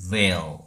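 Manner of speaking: veil as one syllable with English v; veil not 베일. Shown as a demonstration. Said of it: "베일이 아닌". (베일이 아니라 한 음절 veil)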